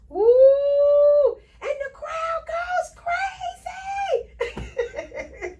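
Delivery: high, hammed-up announcer cheer; squealed crowd-goes-crazy bit; mock crowd cheer. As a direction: play the crowd going wild, way up high.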